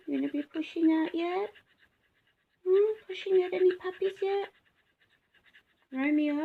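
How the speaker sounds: high sing-song voice for dogs; pet talk. Real, not acted.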